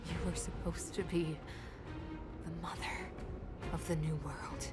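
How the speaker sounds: low hushed threat; menacing whisper. Acted. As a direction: breathe it out darkly.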